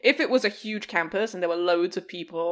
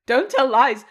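inner voice scolding; 4 semitones higher, 9 dB louder, slower than usual. Retold or imitated. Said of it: "a voice in my head went".

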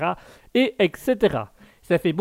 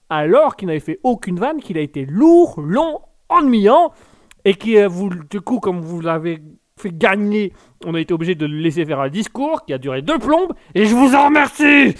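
exasperated rant; 2 semitones higher, 5 dB louder than usual. Acted. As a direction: hammer the complaints, then burst out at the end. Rant over long speech, then calmed.

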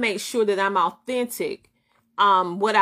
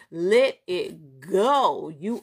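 drawn-out release; 2 semitones lower, much slower than usual. Real, not acted. Urging viewers to let go, slowly drawn out. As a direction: stretch it slowly, savoring each word.

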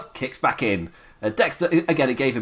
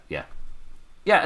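ordinary talk, nothing marked

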